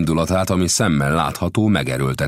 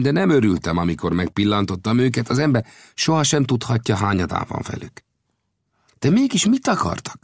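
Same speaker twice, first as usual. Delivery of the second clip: higher-pitched voice for the gambusino, earnest storyteller; gambusino's voice; 9 semitones higher, 3 dB louder than usual. A narrator's reading. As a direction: tell the tale as the friendly gold digger. Gambusino explains.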